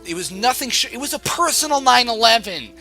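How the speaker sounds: high, loud overwrought lament; anguished outburst. Acted. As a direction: wail it like a total catastrophe. Wailing over a crushing online defeat.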